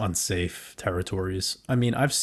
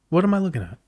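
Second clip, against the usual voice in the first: higher, louder, stunned question; shocked disbelief; 8 semitones higher, 5 dB louder, much faster than usual. Real, not acted. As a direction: blurt it out in disbelief. Stunned at a huge chart move.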